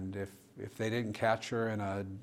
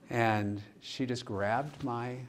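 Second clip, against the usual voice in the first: ordinary talk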